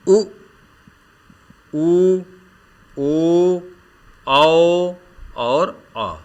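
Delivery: उ, ऊ, ओ, औ said slowly one by one with pauses; back vowel demo. Shown as a demonstration. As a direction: sound each back vowel separately. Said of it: "इन स्वरों के उच्चारण में जीभ का पिछला भाग". (उ, ऊ, ओ, औ को रुक-रुककर अलग-अलग बोलना)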